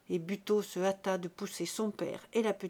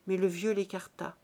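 plain reading voice

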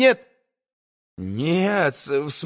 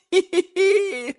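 high falsetto titter; a squeaky little giggle. A narrator's reading. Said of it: "Хихикнул".

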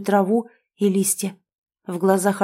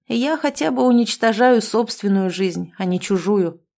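plain reading voice

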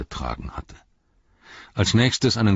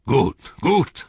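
higher, louder mocking triumph; envoy gloating over the captains' dread, mocking; 8 semitones higher, 6 dB louder than usual. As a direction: savour their fear.

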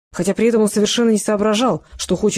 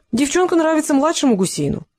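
higher, sneering and smug; goading the boy with a mocking grin; mocking smile; 7 semitones higher than usual. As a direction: taunt him with a smile you can hear. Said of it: "с издевательской улыбкой сообщил очкарик".

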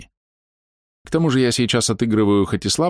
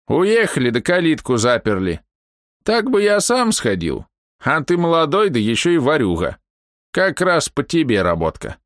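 put-on old man; 8 semitones higher than usual. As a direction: talk him into it like a sly old man. Old man explains the job.

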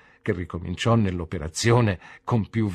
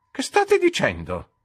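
much higher and louder, sharp; startled question; 7 dB louder than usual. A narrator's reading. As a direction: jump at what he says. Aunt startled by Micawber. (la zia, sorpresa e brusca)

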